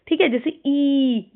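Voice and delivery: "ई" held out long and clear; long ee demo. Shown as a demonstration. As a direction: stretch out "ई" as a model sound. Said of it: "लंबी ई की sound".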